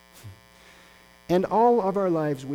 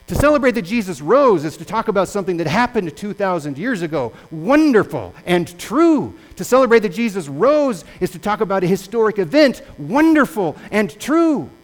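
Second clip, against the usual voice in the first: loud, rising sermon climax; full-force preaching; 4 semitones higher, 7 dB louder than usual. Real, not acted. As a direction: preach it out to the whole congregation. Preaching climax on risen Christ.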